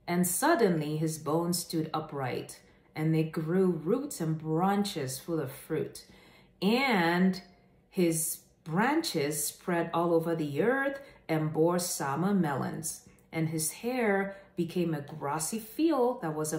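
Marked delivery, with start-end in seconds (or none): none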